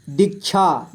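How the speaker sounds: दीक्षा split into दीक, छा; syllable by syllable. Shown as a demonstration. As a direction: separate the two syllables of Diksha. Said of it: "थोड़ा fast पढ़ेंगे". (दीक्षा को तोड़कर पढ़ें)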